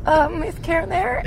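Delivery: small, high, hesitant phone voice; timid friend. Retold or imitated. Imitating a friend phoning after dad answers, timid and high. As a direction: ask it meekly, scared of who answered. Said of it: "intimidated or scared of my dad's hello".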